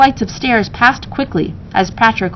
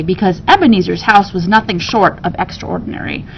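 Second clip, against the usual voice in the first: plain reading voice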